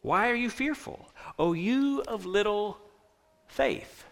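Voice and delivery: hushed, loving reproof; gentle rebuke. Acted. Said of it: "And the gentle rebuke".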